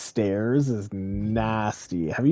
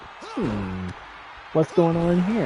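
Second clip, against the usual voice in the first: a light, higher curious pup voice; curious dog voice; 7 semitones higher than usual. Pretend. Speaker voicing the waiting dog, lightly curious. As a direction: play the dog wondering what's happening.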